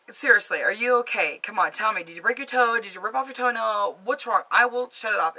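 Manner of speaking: acted-out worried impatience; urgent questioning. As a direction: badger him for an answer. Retold pressing boyfriend for answer.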